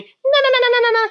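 very high squeaky nagging na-na babble; wife's arguing mimicked. Husband mocks wife's side of the fight, shrill high babble. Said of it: "and she was arguing".

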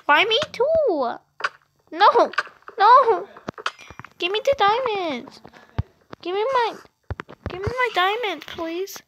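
high, pleading whine; real whiny begging. Real, not acted. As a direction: whine for the diamonds, high-pitched. Begging friend for diamonds.

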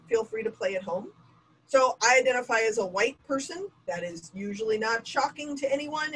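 ordinary delivery

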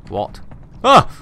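sudden startled yelp. Real, not acted. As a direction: jump at a scare. Startled by monster.

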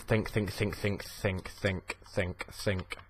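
low-key, soft repeated muttering; thinking to oneself. Real, not acted. Gamer urging himself to find a plan, soft muttering. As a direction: mutter it over and over, softly.

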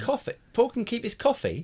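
high, baffled echo question; puzzled. Real, not acted. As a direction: repeat it back in disbelief. Confused echo of odd remark.

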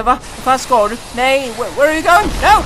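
high, loud and climbing; panicked protest. Real, not acted. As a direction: yelp the questions in rising alarm. Panicking as snake approaches.

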